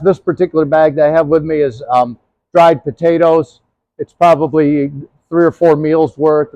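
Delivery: ordinary talk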